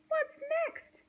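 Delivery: very high, exclaimed question; exasperated yell. A narrator's reading. Hank fed up with passing visitors, yelling. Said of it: "yelled Hank".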